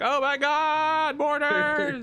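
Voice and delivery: high, drawn-out panicked cry; hammed-up scream of warning; mock alarm. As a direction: wail it way up high, stretching the words. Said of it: "be like".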